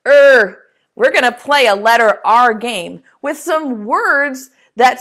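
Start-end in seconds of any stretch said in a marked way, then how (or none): 0.1-0.6 a louder growled "Er." like an angry grunt; grumpy "Er." for kids; mad er sound. Shown as a demonstration. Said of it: "The letter R makes the sound er, like it's mad".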